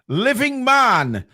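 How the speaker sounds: high, slow, falling winner call; excited announcement. Acted.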